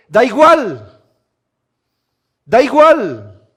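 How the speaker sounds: loud, high, insistent preaching; fiery preaching. Acted. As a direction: drive the questions home at the congregation. Preaching at full force. (predicación a todo pulmón)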